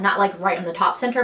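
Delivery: ordinary talk, nothing marked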